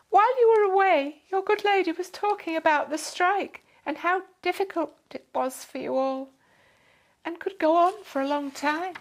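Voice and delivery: high, loud and gushing with thanks; a posh lady gushing her goodbyes; grateful fussy lady. A narrator's reading. Lady raises the strike.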